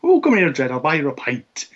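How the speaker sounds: high mock Irish offering a pint; hammy Irish accent, friendly; bad Irish accent. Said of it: "I apologise for the bad Irish accent".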